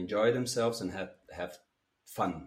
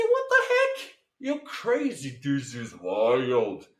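voice play demo; 2 semitones higher, 6 dB louder, much slower than usual. Shown as a demonstration. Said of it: "You can play with the voice".

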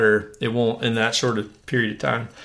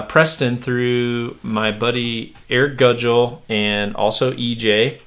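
ordinary talk, nothing marked

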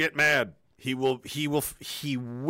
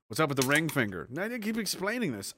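normal talking voice

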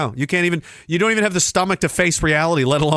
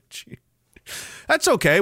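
usual speaking voice

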